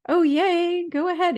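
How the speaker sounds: high, loud, delighted squeal of words; retold burst of eager excitement; all excited. Retelling her reply to a friend's gift offer, all excited. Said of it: "all excited".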